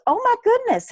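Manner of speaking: very high, gushing mock squeal; imitated excitement. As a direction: play the thrilled lady on the phone. Acting the thrilled retirement office lady.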